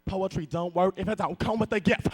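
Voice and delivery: ordinary talk, nothing marked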